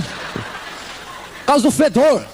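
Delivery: slow words pushed out through laughter; real laughter over the stink; laughing. Real, not acted. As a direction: laugh while you get the words out. Laughing at his own story.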